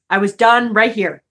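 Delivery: usual speaking voice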